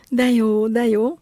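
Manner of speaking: loud happy repeated exclaim; joyful cry. Acted. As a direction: cheer it out with delight. Joyful shouts at the end. (boldog, hangos ujjongás)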